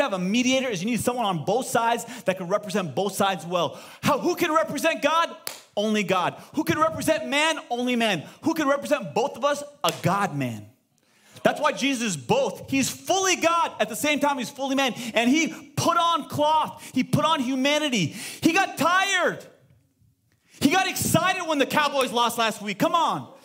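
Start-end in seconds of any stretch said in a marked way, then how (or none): 4.0-9.6 high, ringing questions to the crowd; preaching call. Real, not acted. Preacher drills the congregation, high and ringing. 18.4-19.4 high, slow, punched line; emphatic. Real, not acted. Preacher stresses Jesus was human, high and slow.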